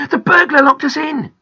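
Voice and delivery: high, loud, alarmed shout; crying out. A narrator's reading. Boy telling Grandpa about the burglar, crying out. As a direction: yell the news through the door. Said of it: "cried Peter".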